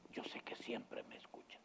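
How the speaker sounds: intimate whisper to the Father; whispered prayer. Pretend. Jesus prays quietly to the Father, whispering. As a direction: breathe the prayer softly.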